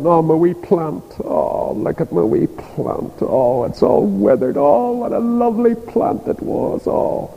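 a mock self-pitying whine over a withered plant; lightly hammed-up moaning, trailing off soft; whining Jonah. Jonah mourning his plant, mock self-pitying whine. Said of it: "if he could only have seen how ridiculous he was".

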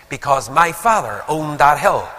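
imitated pride, brisker and fuller voiced; touch of pride. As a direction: say it with a son's mild boast. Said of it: "a wee touch of noted pride".

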